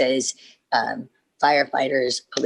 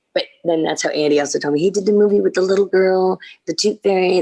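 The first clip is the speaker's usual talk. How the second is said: ordinary talk, nothing marked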